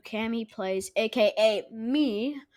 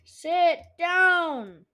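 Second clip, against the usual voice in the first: irritated order; 6 semitones higher than usual. Real, not acted. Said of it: "Starting to rage".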